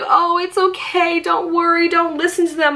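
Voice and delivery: high, cooing reassurance; imitated consoling. Mom to her crying daughter, imitated high consoling.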